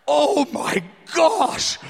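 slow, drawn-out stunned realization; tearing up, awestruck. Retold or imitated. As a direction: act out being overcome, near tears. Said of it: "I begin to tear up".

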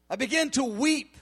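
normal talking voice

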